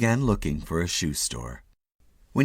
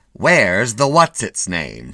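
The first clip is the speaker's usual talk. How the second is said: imagined nagging wife; 2 semitones higher, 8 dB louder, slower than usual. Retold or imitated.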